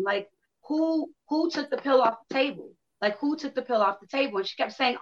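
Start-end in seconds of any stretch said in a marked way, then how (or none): 0.7-4.4 a hint of a frantic mother, higher; mild mock hysterical mother. Mother crying over the missing pill, lightly voiced frantic. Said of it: "she started screaming and she was crying".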